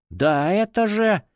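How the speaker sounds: high, drawn-out protest; indignant whine, stretched out. A narrator's reading. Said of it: "возмутился фанатик".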